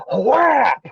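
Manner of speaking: high, punchy "whap" sound effect; imitated impact. Co-host picturing big guard hitting thief, high mock impact. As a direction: yelp out a cartoonish smack.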